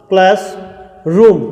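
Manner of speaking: क्लासरूम slowly, class, then short rum; word in parts. Shown as a demonstration. Teacher asking students to take care, slow split word. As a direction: break क्लासरूम in two with a pause. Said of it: "ध्यान रखना".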